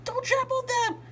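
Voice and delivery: high horrified cry; a gardener begging the others to spare her flowers. A narrator's reading. May to the group, a high horrified cry. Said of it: "May was horrified".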